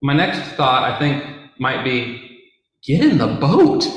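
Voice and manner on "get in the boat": high, loud, half-laughing plea; exasperated urging. Pretend. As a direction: urge him into the boat, amused and alarmed. Imagined exasperated reaction to Jesus.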